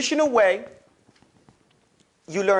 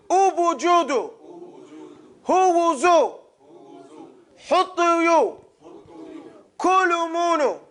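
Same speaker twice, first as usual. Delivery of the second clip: higher, clear chanted drill words; recitation drill; 9 semitones higher, much slower than usual. Shown as a demonstration. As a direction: call each word for the class to echo. Class drill of Arabic syllables.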